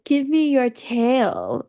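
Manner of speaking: very slow, drawn-out request; Yai Miko. Retold or imitated. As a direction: linger on every word in a put-on character voice. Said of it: "Yai Miko's just over there like".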